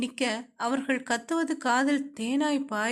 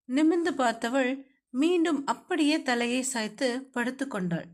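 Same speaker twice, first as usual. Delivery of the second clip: ordinary delivery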